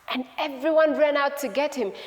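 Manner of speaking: ordinary delivery, nothing marked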